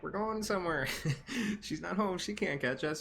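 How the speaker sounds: put-on high-pitched gleeful kids sneaking out; gleeful defiance. Kids defy mom's grounding, sneaking out.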